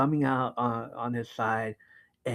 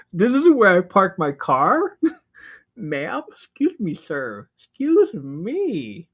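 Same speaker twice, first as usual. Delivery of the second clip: high, hammed-up affronted diva; mock indignant; 8 semitones higher, 9 dB louder, slower than usual. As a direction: play an offended princess, drawing out the protest. Voices indignant cat being flirted with.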